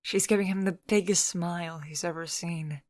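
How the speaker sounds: usual speaking voice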